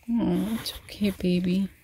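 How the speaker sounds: softly whispered comfort. Real, not acted. Mom soothing dog during thunder, whispered. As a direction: hush everything for the scared dog.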